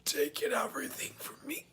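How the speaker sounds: gasping, whispery strangled voice; halting croaked whisper, short of breath; Red's voice. Retold or imitated. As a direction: drag in air, then force the words out. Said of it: "she turns and she's like".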